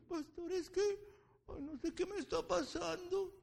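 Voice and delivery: high, whiny mock helplessness; helpless churchgoer. Pastor imitating a member who won't seek God, high whine.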